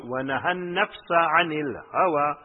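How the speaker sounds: quick recited Arabic verse; fast Quran quote; Quran recitation. Acted. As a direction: rattle off the verse.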